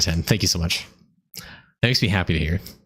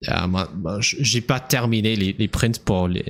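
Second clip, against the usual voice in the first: ordinary delivery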